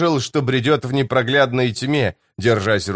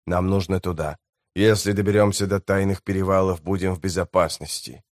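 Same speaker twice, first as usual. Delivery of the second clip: low, soft grim voice of a hardened guide; a quiet vow of revenge, voiced low; low, soft, bitter scout; grim lowlander; 4 semitones lower, 4 dB quieter than usual.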